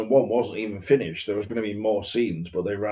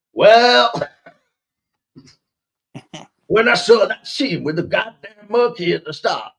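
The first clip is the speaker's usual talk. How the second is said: put-on high, loud outburst from a young film fan; excitable moviegoer; 10 semitones higher, 7 dB louder than usual. Tripping moviegoer defending himself to doubters, hammed-up excitement. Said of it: "That's how they talk in England now".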